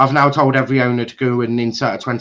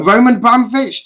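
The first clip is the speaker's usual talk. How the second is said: angry outburst; 8 semitones higher, 4 dB louder, faster than usual. Real, not acted. Ranting at club owners.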